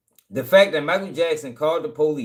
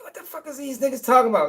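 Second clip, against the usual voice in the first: baffled outburst; 8 semitones higher, faster than usual. Retold or imitated. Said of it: "I'm like".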